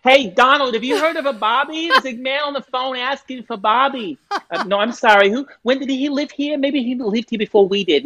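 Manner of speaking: very high, hammed-up old American voice; high-pitched caricature of an American phone-answerer; elderly American. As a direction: call to your spouse as a puzzled old American. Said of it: "old people were the, the best".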